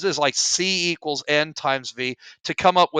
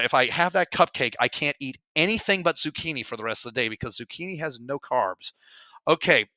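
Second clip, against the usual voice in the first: ordinary talk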